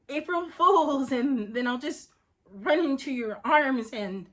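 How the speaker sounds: loud, high, hopeful outburst; desperate hope. Acted. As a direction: build the happy fantasy before it falters. Desperate fantasy of a reconciliation.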